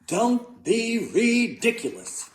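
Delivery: high, slow, emphatic dismissal; scoffing. A narrator's reading. Mother dismissing son's moose story, emphatic scoffing. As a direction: draw out each word in disbelief.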